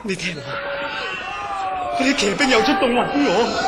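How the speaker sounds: rising to a frightened shout; panicked alarm. Acted. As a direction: cry out as the horsemen come for you. Hears cavalry coming in panic. (驚慌大叫)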